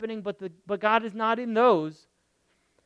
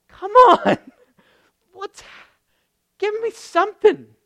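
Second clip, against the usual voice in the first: high, loud, half laughing; exasperated plea; 11 semitones higher, 9 dB louder than usual. Real, not acted. Preacher laughing in exasperation at God's non-answers, high and loud. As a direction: plead in mock frustration.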